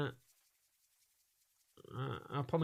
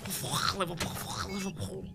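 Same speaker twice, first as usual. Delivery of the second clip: modern Liverpool accent; slower than usual. Shown as a demonstration. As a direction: caricature Scouse accent with harsh, throaty k sounds. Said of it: "that's like a modern Liverpool accent".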